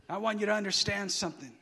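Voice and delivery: ordinary delivery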